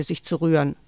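usual speaking voice

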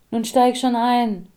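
nervous woman urging him into the taxi; restless urging. A narrator's reading. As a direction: get him in the car quickly. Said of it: "sagte sie unruhig umherblickend".

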